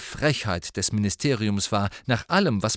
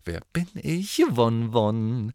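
hoch und verspielt trällernd; neckisch, leicht getrillert; much slower than usual. A narrator's reading. (Lavender trillert Ron ins Ohr, verspielt)

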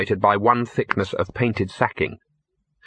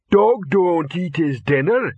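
slow, dim-witted creature voice, pitched up; lumbering slow-witted character; Detritus; 7 semitones higher, slower than usual. A narrator's reading. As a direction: spell it out laboriously like a simpleton. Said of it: "came Detritus's voice".

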